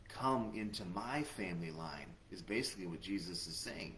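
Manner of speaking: normal talking voice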